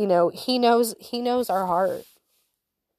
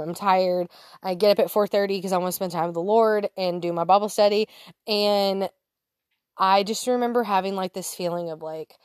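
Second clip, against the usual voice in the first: ordinary talk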